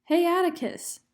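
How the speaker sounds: high, loud "Hey, Atticus"; calling out. Retold or imitated. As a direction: call out to him over the mob. Scout calling into the mob.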